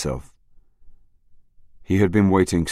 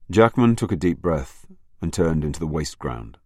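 usual speaking voice

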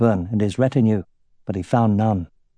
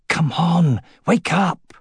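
higher-pitched, pressing urging; urgent, raised-pitch plea to wake; urgent rousing call; 5 semitones higher, slower than usual. Acted. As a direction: rouse someone who is out cold. Urging an unconscious man to wake.

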